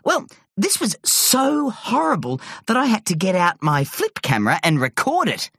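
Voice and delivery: ordinary delivery, nothing marked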